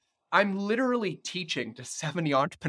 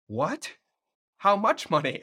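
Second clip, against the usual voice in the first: rising higher and louder, staged disbelief; mock shock; 8 semitones higher, 4 dB louder than usual. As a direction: act stunned at the price. Shocked by son's prize demand.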